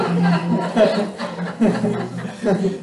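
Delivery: long embarrassed laugh; drawn-out amused laugh. Real, not acted. Laughs recalling class.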